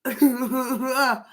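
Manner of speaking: delighted laughter; happy laugh. Real, not acted. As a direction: laugh with joy. Joy at milestone.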